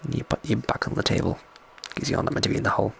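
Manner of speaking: soft near whisper; hushed aside to someone nearby; quiet aside. Real, not acted. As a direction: murmur it off mic.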